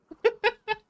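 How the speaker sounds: high, loud laugh; real high-pitched laughter; burst of laughter. Real, not acted. Laughing after protest.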